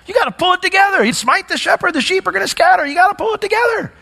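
high, exasperated mock rant; upset scolding. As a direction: berate your right-hand guy, voice pushed way up. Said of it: "I would probably be a little upset".

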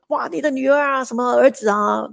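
half-hearted mock scolding of the neighbour; lightly voiced angry neighbour; neighbour's mock angry complaint. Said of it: "哇，气呼呼的".

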